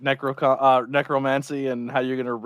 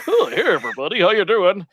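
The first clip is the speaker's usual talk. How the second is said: higher, louder, over-cheery host parody; fake TV host voice; 6 semitones higher, 6 dB louder than usual. Mocking the morning TV host greeting the band, hammed-up cheer. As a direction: ham up a smarmy morning show host. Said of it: "put on a stupid fake voice".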